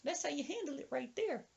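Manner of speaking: high, softened falsetto boast; triumphant old woman. Retold or imitated. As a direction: gloat in a squeaky granny voice. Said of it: "She says".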